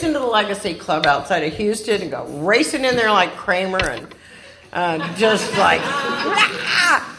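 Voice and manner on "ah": panicked cry. Retold or imitated. Racing into the meeting.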